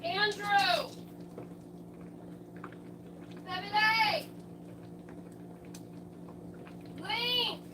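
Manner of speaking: calling out from another room; shouting names from far off; walking away. Real, not acted.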